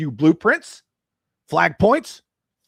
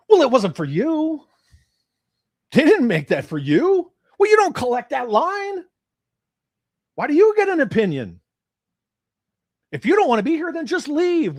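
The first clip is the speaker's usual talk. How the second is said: higher and louder, exasperated; heated rant; 10 semitones higher, 4 dB louder than usual. Real, not acted. Host telling off critics who don't collect, angry. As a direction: tell them off, fed up. Ranting at complaining non-collectors.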